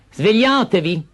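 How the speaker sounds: high, sharp order; commanding. Acted. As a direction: bark them awake. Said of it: "ordinò la matrigna".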